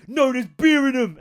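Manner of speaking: snapped. Retold or imitated. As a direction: bark it like a fed-up mum. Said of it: "her mum just snapped and go".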